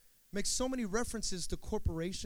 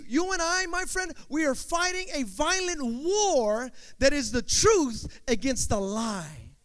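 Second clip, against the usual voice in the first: loud, raised-pitch sermon delivery; full-force preaching; 6 semitones higher, 10 dB louder than usual. Real, not acted. Preacher urging the congregation to fight for truth, full-force preaching. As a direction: preach it out with stretched key words.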